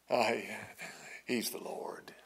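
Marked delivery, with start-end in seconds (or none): 1.2-2.1 whispered. Real, not acted. Preacher repeats the Lord claim.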